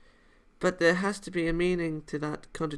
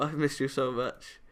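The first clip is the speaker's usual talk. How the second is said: normal talking voice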